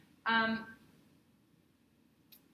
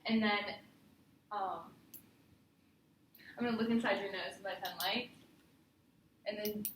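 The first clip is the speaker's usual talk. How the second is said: usual speaking voice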